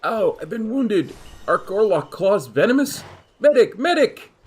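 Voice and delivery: hammed-up wounded cry for a medic; high, dramatic call for help; man down shout. Reads wounded character crying for medic.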